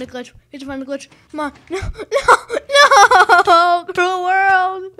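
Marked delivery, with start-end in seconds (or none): 1.7-4.9 high, very loud melodramatic wail; theatrical cry of defeat; mock-anguished scream. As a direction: scream it like a hammed-up death, half laughing. Mock death cry as he loses.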